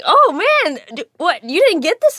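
high, loud retold exclamation; surprised director. Director reacting to her audition result, high loud disbelief. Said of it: "he was like".

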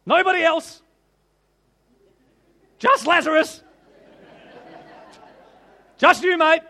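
loud, high calling voice. Acted. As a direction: keep calling across the crowd. Preacher keeps calling voice.

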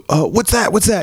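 high, jittery mock-scared question; nervous man voice. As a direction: ask it like a jumpy scared guy.

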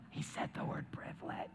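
whispered aside, voice gone. Real, not acted. Preacher whispers a teasing aside to the congregation. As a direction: hush it like a secret joke. Joking aside after offending remark.

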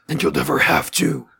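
superhero's rough, rasping reply; Batman. Acted. Podcaster answering as the hero on the roof, Batman voice. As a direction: rasp it out like a masked vigilante. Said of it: "Oi, Batman!".

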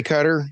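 Qatar with first-syllable stress, KUT-er; Qatar as "cutter". Shown as a demonstration. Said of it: "do you say".

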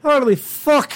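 hammed-up Irish accent as a clurichaun; bad Irish accent. Co-host plays colorblind clurichaun wanting green, bad Irish accent. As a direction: play an exasperated Irishman, badly. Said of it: "the worst Irish accent right now".

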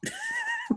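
sudden squeaky high laugh; a squeaky laugh that makes the co-host ask about the noise; odd high laugh. Real, not acted. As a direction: let out a strange high-pitched giggle. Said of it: "what was that noise for".

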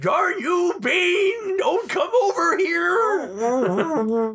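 high, slow yelling prospector; raspy prospector shout. Retold or imitated. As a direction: holler like a scared old miner. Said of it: "this raspy voice".

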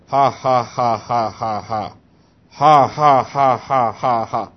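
low, dry, put-on "ha ha"; imitated mirthless laugh, falling away; dry forced laugh. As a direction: fake a laugh with no amusement. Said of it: "He said it sounded just that dry".

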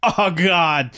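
hammed-up agonized exclamation; mock pained groan. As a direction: imitate a man discovering his hurt leg. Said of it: "and he's like".

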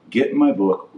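ordinary delivery

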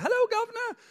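high-pitched mock British greeting; British accent. Shown as a demonstration. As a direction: put on a hammed-up British accent. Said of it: "I did a British accent with".

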